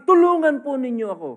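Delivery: pleading woman. Acted. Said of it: "At sinabi".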